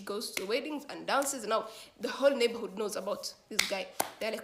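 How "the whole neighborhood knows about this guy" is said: ordinary delivery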